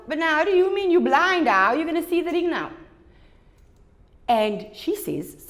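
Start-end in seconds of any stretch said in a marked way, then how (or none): 0.1-2.7 high, louder disbelief; baffled boyfriend. Acted. Young man baffled that blind Eva wants to see the ring, high. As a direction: ask it high and bewildered. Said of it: "he's thinking to himself".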